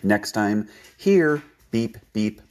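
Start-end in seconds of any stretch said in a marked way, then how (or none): 1.0-1.4 a high, louder "Here" that falls away; inviting recall call. Shown as a demonstration. Trainer calling the dog home, an inviting call. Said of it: "good voice inflection". 1.7-2.4 quick vocal beeps mimicking a whistle. Shown as a demonstration. Trainer showing the recall whistle, quick vocal beeps. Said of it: "beep with your whistle".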